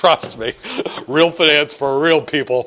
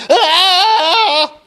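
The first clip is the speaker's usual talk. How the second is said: high, loud fleeing scream with a laugh in it; 13 semitones higher, 6 dB louder than usual. Retold or imitated. Teacher acting out fleeing the building, screaming. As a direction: shriek like you're bolting from the building. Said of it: "running out of the building screaming".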